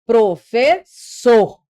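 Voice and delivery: drawn-out professor ending on a strong SOR; last syllable stressed. Shown as a demonstration. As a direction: break professor up and punch the final sor. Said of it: "A gente fala professor".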